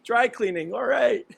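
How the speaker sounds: high, cartoonish gushing clerk voice; dry cleaners. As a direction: squeal it like an overexcited dry cleaner. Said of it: "They're like".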